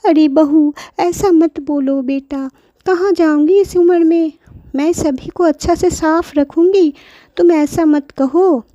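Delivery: grandmother pleading. Acted. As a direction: beg gently like a hurt grandmother.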